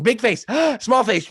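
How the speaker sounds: high gasp between the words; big face gasp drill. Shown as a demonstration. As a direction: gasp wide, then shrink to small face. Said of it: "we go back and forth".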